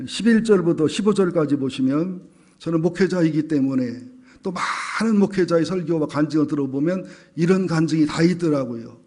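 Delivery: ordinary talk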